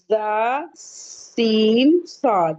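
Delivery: سین with a long drawn whistling hiss; whistling sibilants. Shown as a demonstration. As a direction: hold the س hiss slowly, whistle through the teeth. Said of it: "تین حروف میں سیٹی کی آواز نکلتی ہے". (سیٹی کی آواز کے ساتھ لمبا کھینچ کر)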